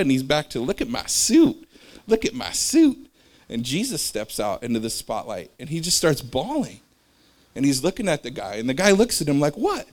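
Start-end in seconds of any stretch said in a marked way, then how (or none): 0.6-3.1 high, loud, slow show-off; gleeful boasting. Retold or imitated. Proud man showing off. 9.6-9.9 squeaky high confused what; puzzled what. Retold or imitated. Guy confused at Jesus.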